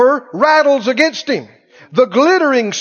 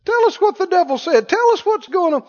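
calling out; 6 semitones higher than usual. Retold or imitated. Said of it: "And we'd say".

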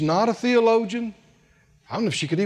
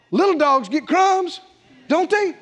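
higher-pitched coaxing question; woman's hopeful plea; 8 semitones higher than usual. Acted. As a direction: plead her case, lifting the final question. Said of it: "She, she's saying".

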